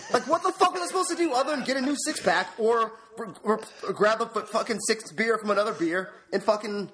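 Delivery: high-pitched exasperated rant; worked up and indignant; triggered. Real, not acted. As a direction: vent in baffled outrage, pitch riding high. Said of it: "You're triggered".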